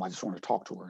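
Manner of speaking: very soft, low quoted plea; subdued imitation of grief; retold tearful plea. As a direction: repeat his plea in a hushed voice. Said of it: "he was crying for a while".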